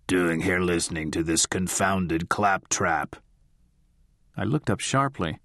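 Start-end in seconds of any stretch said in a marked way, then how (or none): 0.1-3.2 put-on lower, slow grumbling old man; gravelly baritone. A narrator's reading. Spirit voice scolding the audience, grumpy low growl. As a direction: growl it like a cranky old codger. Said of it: "to a gravelly baritone".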